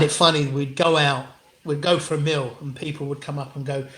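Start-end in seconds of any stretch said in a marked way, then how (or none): none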